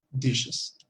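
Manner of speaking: Dishes with sh sound and falling tune; model pronunciation. Shown as a demonstration. Teacher modeling Dishes for the class. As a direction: say Dishes clearly, sh in the middle. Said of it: "esto sería dishes".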